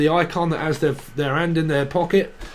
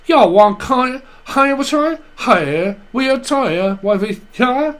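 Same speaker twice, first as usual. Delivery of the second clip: a lightly voiced American accent, pitched higher and louder; a playful American-accent parody with a raised voice; hammed-up mock American accent; 8 semitones higher, 5 dB louder, slower than usual. Psychic mocks how Americans talk, in a high, loud American accent. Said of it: "where y'all talk like".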